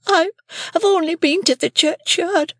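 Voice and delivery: put-on high, tearful child's voice; Pip, beaten, answers his sister crying; crying boy. A narrator's reading. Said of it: "crying and rubbing myself".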